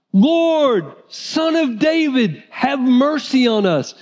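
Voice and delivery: high, slow pleading call; pleading cry. Retold or imitated. Blind men begging Jesus to notice them, high slow plea. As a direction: call out for mercy, drawn out. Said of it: "They had to be obnoxiously yelling".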